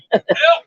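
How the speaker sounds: high-pitched short laugh; real burst of laughter. Real, not acted. Commentator laughing at the lane action, high short laugh. Commentator chuckles during the match.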